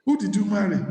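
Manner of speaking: ordinary delivery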